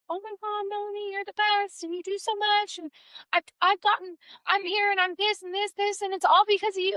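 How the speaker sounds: high-pitched imitation of a grateful client; gushing client. Client crediting her helper for everything, gushing. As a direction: gush admiringly, voice pitched well up. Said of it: "I have a client all the time".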